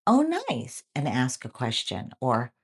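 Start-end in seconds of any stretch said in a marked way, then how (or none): 0.1-0.8 interested reaction. Shown as a demonstration. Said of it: "So you would say something like".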